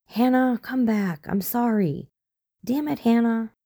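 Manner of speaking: calling out. Acted. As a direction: call out into the forest, worried. Chakotay calling into forest.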